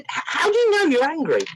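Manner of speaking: retold probing question, emphatic "how"; insistent repeated question. Retold repeat of his puzzled question.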